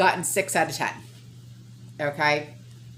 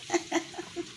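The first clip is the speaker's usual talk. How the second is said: soft breathy laugh; 11 semitones higher, 9 dB quieter than usual. Real, not acted.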